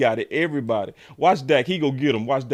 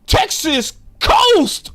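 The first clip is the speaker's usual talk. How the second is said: drawn-out high loud mock excitement; sarcastic fan gushing; mock hype; 14 semitones higher, 11 dB louder, much slower than usual. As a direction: ham up the fans' hype. Mocking hype over new offense.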